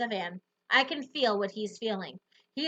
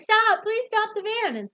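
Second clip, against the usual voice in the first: panicked yelling; 11 semitones higher, 5 dB louder than usual. Acted. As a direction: beg the driver to stop, yelling. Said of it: "yelling stuff like".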